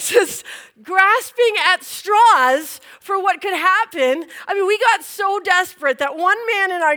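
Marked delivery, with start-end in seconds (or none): none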